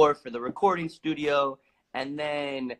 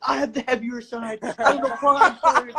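high, complaining friend, pitched up; whiny friend; 9 semitones higher, 6 dB louder than usual. Retold or imitated. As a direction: whine about the heavy load in a high voice. Friend complains while carrying door.

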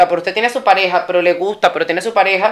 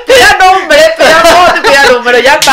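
emphatic advice; 6 semitones higher, 7 dB louder than usual. Acted. Comic advice to the audience.

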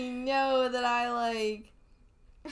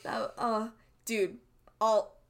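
normal talking voice

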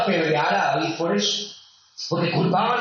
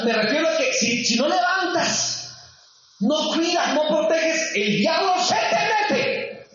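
high-pitched, urgent pulpit warning; forceful preaching; 9 semitones higher, slower than usual. Acted. Preacher warns the congregation about the devil, forceful preaching. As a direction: build higher and drag out the warning.

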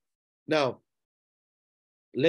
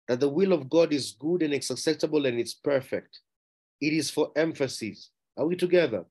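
normal talking voice

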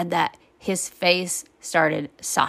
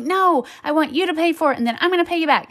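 put-on high-pitched kid's objection; insistent child; 8 semitones higher, faster than usual. Son negotiating with mom at checkout, insistent. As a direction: imitate a child bargaining insistently.

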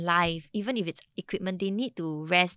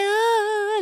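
high, loud mock bawl; hammed-up "ah" of a crying kid; imitated child wail; 14 semitones higher, 5 dB louder than usual. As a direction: wail like a kid bursting into tears. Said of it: "he went".

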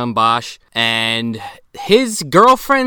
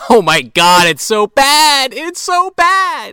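high, loud, drawn-out wail of disgust; cringing cry at terrible filmmaking; anguished loud groan; 13 semitones higher, 8 dB louder, slower than usual. Real, not acted. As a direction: wail it, pained by how bad it is. Groaning over the bad footage.

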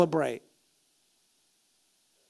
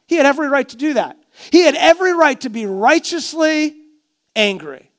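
rising, louder sermon build; fervent preacher climbing in force; preaching; 7 semitones higher, 5 dB louder than usual. Acted. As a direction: drive the point home from the pulpit.

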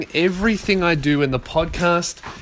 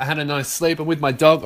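ordinary talk, nothing marked

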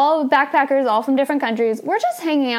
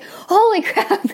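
laughing surprise; 9 semitones higher, slower than usual. Retold or imitated. Meeting young Neil, shocked.

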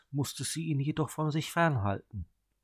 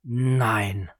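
cold refusal; 6 dB louder than usual. A narrator's reading. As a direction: shut her down coldly. Brother refuses flatly.

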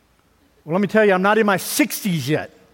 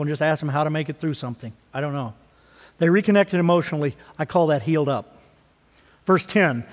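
ordinary talk, nothing marked

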